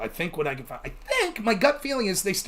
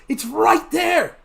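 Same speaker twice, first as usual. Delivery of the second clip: exasperated shout; 9 semitones higher, 6 dB louder, much slower than usual. Retold or imitated. Exasperated over missed evidence.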